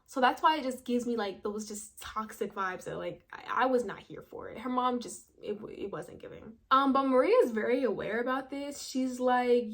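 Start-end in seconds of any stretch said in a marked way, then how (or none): none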